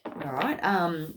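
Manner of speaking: normal talking voice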